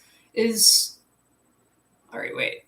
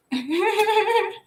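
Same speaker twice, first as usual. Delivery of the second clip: high giggle; 11 semitones higher, 4 dB louder than usual. Real, not acted. Laughing at energy.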